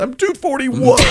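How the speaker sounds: laughing comeback to the angry mom; laughing retort. Retold or imitated. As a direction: blurt it out laughing. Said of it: "I said".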